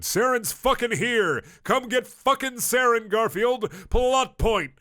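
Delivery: high, loud hammed-up yell; mock shouted sign. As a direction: bellow it like a joke banner.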